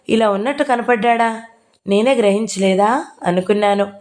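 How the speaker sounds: ordinary delivery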